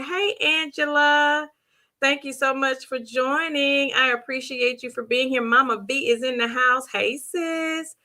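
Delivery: normal talking voice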